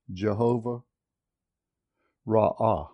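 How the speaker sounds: ordinary talk, nothing marked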